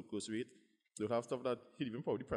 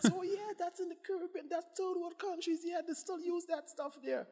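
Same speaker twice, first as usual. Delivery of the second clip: condescending doctor. Retold or imitated. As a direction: lecture him from above.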